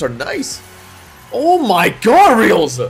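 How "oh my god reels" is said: amazed. Real, not acted. Big hit reaction.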